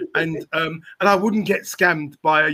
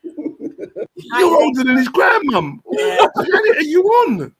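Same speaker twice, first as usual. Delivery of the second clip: incredulous mock wail; 10 semitones higher, much slower than usual. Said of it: "And you're like".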